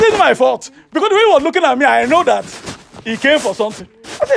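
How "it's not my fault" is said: louder, higher protest through laughter; laughing protest. Retold or imitated. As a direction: laugh while pleading innocence. Defending himself to Jesus.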